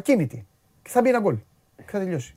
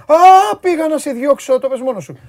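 high, loud outburst easing off; sudden loud exclamation; 9 semitones higher, 10 dB louder, slower than usual. Real, not acted. Host catching himself mid-banter with co-host, sudden loud exclamation. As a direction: burst out with a long, high "Α".

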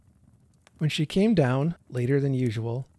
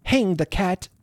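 high, loud indignant outburst; angry woman crying out; storming cry; 7 semitones higher, 6 dB louder than usual. A narrator's reading. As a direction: storm it out, pitched up. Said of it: "Missus Jenkins stormed and cried".

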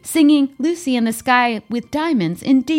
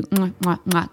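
big wet smooch; 6 semitones lower, 4 dB quieter than usual. Real, not acted. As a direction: blow big wet kisses. Blowing kisses to the audience.

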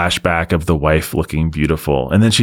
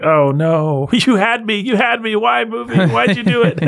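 high, hammed-up whimper to the movie; overdone tearful whine, half laughing; mock heartbroken plea; 13 semitones higher than usual. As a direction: plead with the movie as if betrayed.

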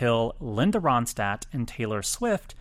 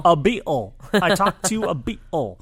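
higher, louder giddy boast; excited; 7 semitones higher, 5 dB louder than usual. Real, not acted. Host boasting to co-host about the Beatle call, giddy.

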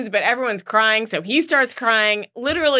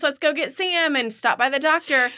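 bright, higher fake-cheery announcement to kids; forced cheerful; 6 semitones higher than usual. Mom spinning a doctor visit as a treat, forced cheer. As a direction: sell the outing with a forced smile. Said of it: "And I'm like".

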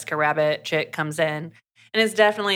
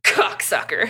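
muttered insult; 3 semitones higher than usual. Retold or imitated. Miller insults Scott passing by.

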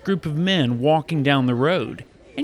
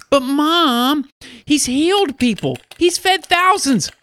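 put-on high, insistent kid voice, climbing higher; pleading child; 10 semitones higher than usual. Child begging busy mom to go meet Jesus, pleading. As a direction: argue your case to mom in a rising child's pitch.

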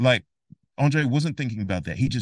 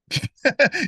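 burst of laughter; 4 dB louder than usual. Real, not acted. Laughs at own impression.

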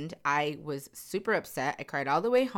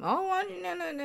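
mock rejecter; 13 semitones higher, faster than usual. Voicing the rejecting interviewer.

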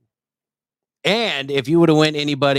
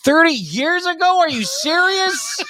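disbelieving; slower than usual. Real, not acted. Disbelief at thirty years.

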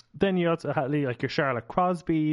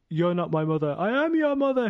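soap opera quote; 5 semitones higher, 3 dB louder than usual. Retold or imitated. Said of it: "a famous soap scene".

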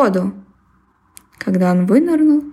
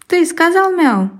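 stern question; 8 semitones higher than usual. Acted. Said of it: "строго спросил его щенок".

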